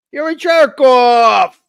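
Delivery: mock shouted insult. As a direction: bellow it at a buddy, ball-busting. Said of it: "that's how we talk".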